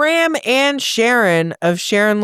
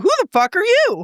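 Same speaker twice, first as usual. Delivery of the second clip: outraged disbelief; 11 semitones higher than usual. Retold or imitated. Outraged at non-information booth.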